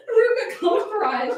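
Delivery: normal talking voice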